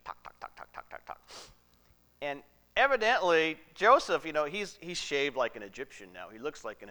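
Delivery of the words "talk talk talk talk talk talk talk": whispered imitation of servants' chatter; gossiping. Slaves passing on gossip, in a hushed mock chatter. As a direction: rattle it off under your breath.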